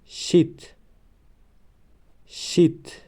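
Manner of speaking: شیت read clearly, pitched high; syllable demo. Shown as a demonstration. Teacher models شیت for pupils, high and clear. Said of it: "دیگرش".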